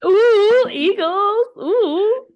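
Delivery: high, loud bird shriek; eagle screech. Pretend. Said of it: "never heard an eagle make a noise".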